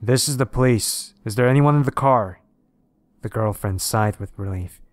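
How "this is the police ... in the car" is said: loud, raised police call; higher, louder shouted announcement; shouting. A narrator's reading. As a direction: call out like an officer to someone in a car. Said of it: "she heard a voice shouting".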